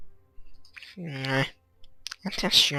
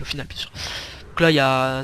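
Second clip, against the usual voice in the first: normal talking voice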